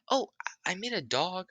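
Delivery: put-on high-pitched child's voice; high kid voice. Kid answering Naruto in a high voice. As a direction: squeak it out like a small boy.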